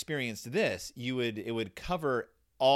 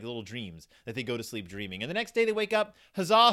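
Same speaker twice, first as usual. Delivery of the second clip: ordinary talk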